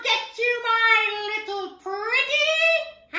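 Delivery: very high, loud, drawn-out witch. Acted. Voice teacher playing the witch, high piercing screech. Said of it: "our witch".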